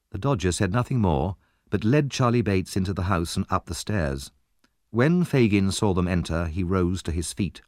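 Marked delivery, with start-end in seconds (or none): none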